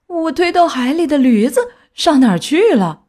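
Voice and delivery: high, loud mock-tearful wail. A narrator's reading. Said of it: "结结巴巴地哭着说". (带着哭腔高声说)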